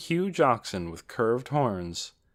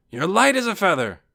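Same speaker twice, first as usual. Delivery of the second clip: loud, high teasing; hearty nephew; 10 semitones higher, 7 dB louder than usual. A narrator's reading. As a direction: boom it cheerfully at an aunt. Nephew teases aunt after lifting her.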